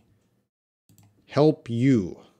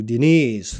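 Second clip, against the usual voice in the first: normal talking voice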